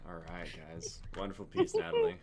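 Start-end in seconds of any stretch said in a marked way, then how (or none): 1.2-2.2 high and loud, rising onto the name; gushing praise. Real, not acted. Host cheering Natalie's piece, voice climbing high and loud.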